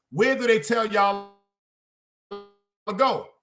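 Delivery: calling out to crowd. Acted.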